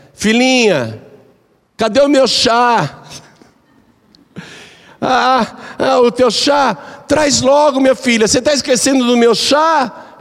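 put-on high, doting old woman asking; sweet mother-in-law. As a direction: coax it like a fond old lady. Mother-in-law asks for tea. (sogra carinhosa, voz bem aguda)

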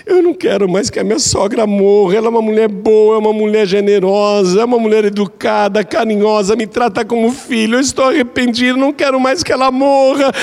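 very high, pleading, near-tearful begging; desperate remorse. Acted. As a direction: beg the wise man for the antidote. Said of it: "chegou lá arrependida". (nora desesperada implorando, voz bem aguda)

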